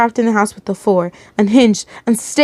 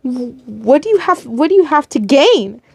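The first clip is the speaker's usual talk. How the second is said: higher, stammering questions; shaken pleading; 5 semitones higher than usual. A narrator's reading. Captive asking the captor why, distressed. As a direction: plead for a reason, stumbling over words. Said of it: "starting to softly shake".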